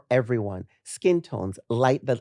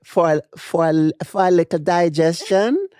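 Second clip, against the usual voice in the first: Jamaican accent, "digestion" said higher and louder; 3 semitones higher, 6 dB louder than usual. Shown as a demonstration. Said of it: "I can't do a Jamaican accent".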